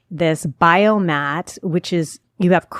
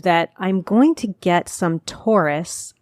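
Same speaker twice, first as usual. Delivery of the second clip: ordinary talk, nothing marked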